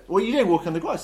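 high, loud telling-off; scolding palace guard. Acted. Guards catching a palace intruder, scolding. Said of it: "and they just go".

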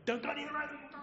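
high-pitched mock yell, held back in volume; imitated mother's yell. Mother warning her toddler, high mock yell. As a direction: replay the warning high without the force. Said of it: "a mother who yells at her overactive toddler".

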